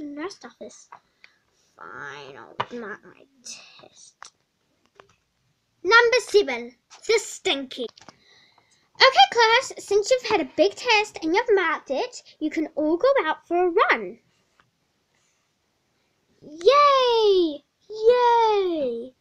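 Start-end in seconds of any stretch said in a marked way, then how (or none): 1.8-4.3 low, soft, whispered grumble; defeated whisper. Acted. Depressed student giving in to the teacher, whispered. 9.0-14.2 higher, louder teacher announcement; cheery teacher. Acted. Teacher announcing a run to the class, bright and loud. 16.4-19.1 put-on high, loud kid's yay; excited cheer. Students cheering about the run, loud and high.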